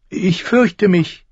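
high, slow frightened voice. A narrator's reading. Boy afraid to look down, frightened. Boy admits fear to crow.

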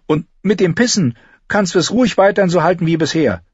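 animated argument between the two; character voices. Acted. Boy and girl argue over titles, lightly voiced.

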